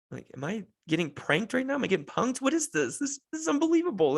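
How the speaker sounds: incredulous, pitch climbing high and fast; mock disbelief. Dad grilled by a toddler, high mock-incredulous rant. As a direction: act baffled, as if being pranked.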